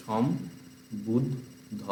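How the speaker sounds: সম্বুদ্ধ very slowly and softly as som - bud - dho; slow practice split of সম্বুদ্ধ; broken into syllables. Shown as a demonstration. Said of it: "ভেঙে ভেঙে অভ্যাস করতে হবে".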